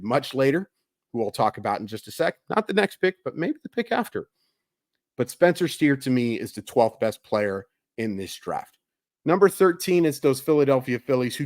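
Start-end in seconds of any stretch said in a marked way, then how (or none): none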